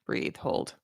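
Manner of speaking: hushed yoga cue; whispered instruction. Shown as a demonstration. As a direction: breathe it out softly. Guiding a yoga pose.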